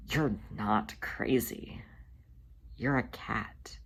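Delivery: slow, hushed reassurance; gentle whispered comfort; soothing whisper. Acted. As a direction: whisper it to calm him. Tugger soothing crying brother.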